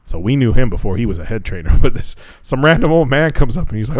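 ordinary talk

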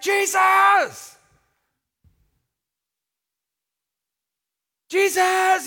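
full-force, high, drawn-out scream; passionate shout. Acted. Blind beggar calling Jesus over the crowd, passionate shout. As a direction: yell the name with everything you have. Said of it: "He was extremely passionate. He was like".

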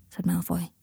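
usual speaking voice

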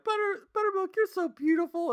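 put-on squeaky, very high kid falsetto; cartoon kids. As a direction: imitate little kids gushing over a pony. Voicing adoring cartoon kids again.

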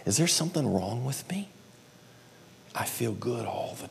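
hushed, worried whisper to his wife; softly whispering to wife, anxious; whispered confession. Retold or imitated. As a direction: whisper it like a secret worry. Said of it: "going to my wife saying".

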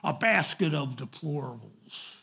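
ordinary delivery

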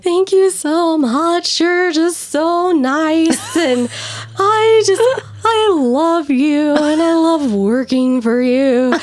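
put-on high, slow, syrupy drunken thanks; gushing drunk. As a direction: fawn over the boss like a sloppy drunk. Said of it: "She had all the emotions".

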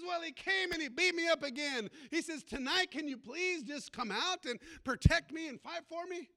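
a beaten man begging Jesus, loud and desperate; loud desperate plea. Acted. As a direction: plead loudly to be protected.

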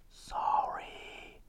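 tiny whispered apology; meek whisper. Acted. Wife answering angry husband, meek whisper. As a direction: shrink down to a bare whisper. Wife's meek reply to shouting.

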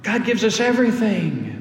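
ordinary talk

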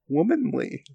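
normal talking voice

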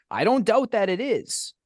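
high, exasperated concession; sarcastic mock outrage. Arguing with a friend about sandwiches, in high sarcastic exasperation. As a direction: grant the point with mock disbelief. Berating friend over his order.

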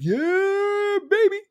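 very high, drawn-out celebratory cry; gleeful cheer. Retold or imitated. Host recalls cheering the mayor's stance, high gleeful cry. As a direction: crow it in a high, thrilled voice. Excited quote of his own reaction.

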